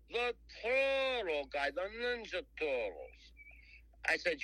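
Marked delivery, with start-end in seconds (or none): 0.1-3.3 put-on high, slow, drawn-out New Jersey accent; Jersey guy. Jersey caller introducing himself, high slow Jersey accent. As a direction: announce yourself like a proud New Jersey hustler. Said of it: "he talks just like a guy from Jersey".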